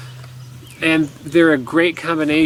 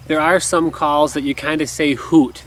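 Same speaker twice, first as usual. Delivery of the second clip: normal talking voice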